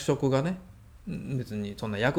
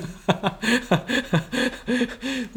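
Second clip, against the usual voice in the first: a real burst of laughter at the memory; laughing; 9 semitones higher than usual. Real, not acted. Man laughs recalling fights with his brother, laughing.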